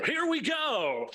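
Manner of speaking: sports announcer voice. Shown as a demonstration. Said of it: "some kind of big, like, sports announcer voice".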